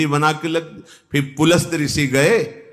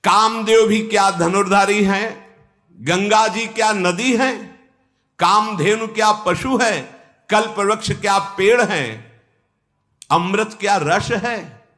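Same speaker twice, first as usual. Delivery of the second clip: raised pitch, each question thrown as a challenge; indignant rhetorical questioning; 8 semitones higher than usual. Acted. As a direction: fire off incredulous questions in a high register. Angad indignantly challenges Ravan. (ऊँचे स्वर में चुनौती भरे सवाल)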